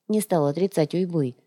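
plain reading voice

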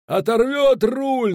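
high, drawn-out frantic shout; straining yell. A narrator's reading. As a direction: yell it at the top of your range, stretching the words. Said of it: "надрывался Пудлорок".

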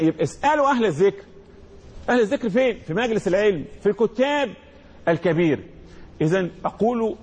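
usual speaking voice

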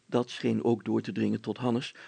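plain reading voice

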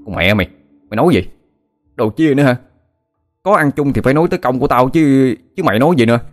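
furious swearing over the fish; angry cursing. A narrator's reading. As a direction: hurl the insults at his friend. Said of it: "chửi An liên hồi".

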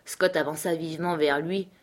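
plain reading voice

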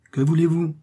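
light, amused resignation. A narrator's reading. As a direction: toss it off lightly, pitch lifted. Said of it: "dit-il en riant". (résignation amusée, voix plus haute)

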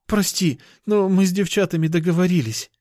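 put-on high, apologetic girl's voice; guilty cat girl. Girl backing away from her lover, high and guilty. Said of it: "смотрела на меня виноватым взглядом".

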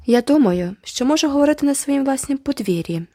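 high, defiant retort. A narrator's reading. Girl defending her own yard, defiant. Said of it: "відказала Джеральдіна".

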